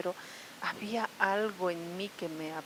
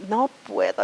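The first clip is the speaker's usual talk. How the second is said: a loud, desperate cry of no puedo; shouting in despair; 3 semitones higher, 9 dB louder than usual. Retold or imitated. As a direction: throw it up at the sky as a real cry. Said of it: "de verdad grité". (grito desesperado hacia el cielo)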